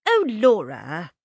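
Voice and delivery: loud, drawn-out exasperated cry of the name; an older sister losing patience; annoyed. Acted. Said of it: "Josie began to be seriously annoyed".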